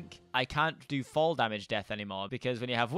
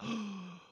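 gasp; 6 semitones higher, 9 dB quieter than usual. Real, not acted. Startled at vampire proof.

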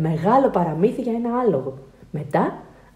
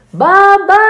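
loud, high, singing-out call; child shouting; 10 semitones higher, 10 dB louder than usual. A narrator's reading. Alfons calls Dad about a wet bed, shouting. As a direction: yell for Dad at full voice. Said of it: "φωνάζει ο Άλφονς".

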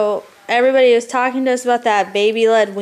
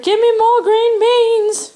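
put-on much higher, slow baby demand; imitating baby son; 10 semitones higher, 4 dB louder, slower than usual. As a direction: voice the baby complaining for more. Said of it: "He was like".

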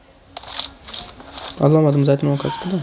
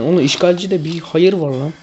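normal talking voice

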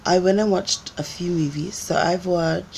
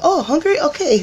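much higher, louder friendly offer; mock Korean dudes; 12 semitones higher, 6 dB louder than usual. Korean guys offering her pizza, high and friendly. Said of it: "And they're like".

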